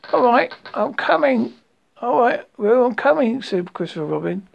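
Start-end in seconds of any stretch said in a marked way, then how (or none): 0.1-3.4 calling out. A narrator's reading. Christopher Robin calling to Roo while rushing over, raised call. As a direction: call ahead in a raised pitch. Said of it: "they all calling out".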